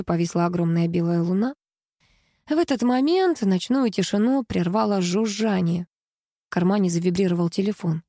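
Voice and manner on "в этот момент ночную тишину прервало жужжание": ordinary delivery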